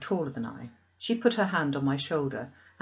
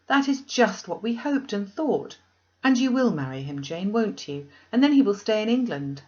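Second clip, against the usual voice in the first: delighted; 5 semitones higher, 5 dB louder than usual. Acted. Diana delighted at the news.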